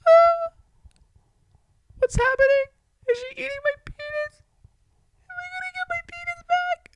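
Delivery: very high panicked cries; panicked squeal. Retold or imitated. Panicked cries inside her.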